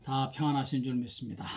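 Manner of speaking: ordinary talk, nothing marked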